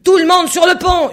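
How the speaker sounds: hurlement d'ordre, très haut et très fort; ordre hurlé. A narrator's reading. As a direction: appelle tout l'équipage à pleine voix. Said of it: "hurla-t-il". (le capitaine hurle l'ordre à l'équipage)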